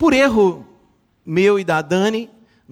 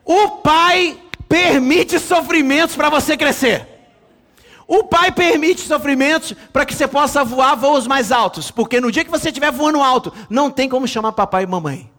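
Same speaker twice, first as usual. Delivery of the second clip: full-force preaching; 7 semitones higher, 6 dB louder than usual. Acted. Preacher proclaiming to the congregation, full-force preaching. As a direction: drive the point home to the church.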